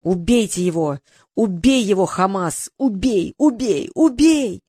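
defeated chief screaming orders at his guard; desperate shouting. A narrator's reading. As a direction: beg the guard to kill, rising each time. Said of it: "выронил меч и закричал".

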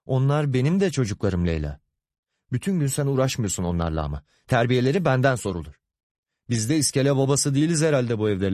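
tiz, hızlı, sitemli atışma; karı koca kavgasını canlandır, hızlı ve tiz; hararetli tartışma. Acted. Karı koca çocuklar yüzünden tartışıyor. (kızgın eş atışması)

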